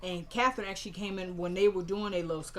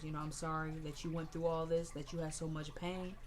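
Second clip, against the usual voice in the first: soft, lowered farewell over the casket; imitating a grandmother's soft goodbye; tender grief; 3 semitones lower, 7 dB quieter than usual. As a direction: speak gently to the dead grandson.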